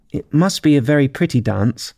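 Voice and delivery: a touch of timid politeness. Acted. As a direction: venture it shyly. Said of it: "said Alice timidly".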